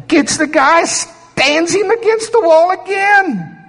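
high, stretched, insistent narration; dramatic storytelling. Acted. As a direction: act out trying with greater force. Acting out the second attempt.

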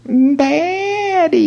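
tiny, very high squeak of a daughter; little mouse voice. Retold or imitated. Daughter calling at Norval's bedroom door, imitated mouse voice. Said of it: "her voice sounded like a little mouse".

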